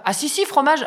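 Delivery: high, overriding insistence; insisting host. Retold or imitated. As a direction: brush off her no cheerfully. David insiste encore. (David insiste, voix haute)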